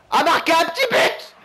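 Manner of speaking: high, sudden outburst; exasperated gamer shout; frustrated shout. Real, not acted. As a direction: yell it out mid-match. Missed goal during live match.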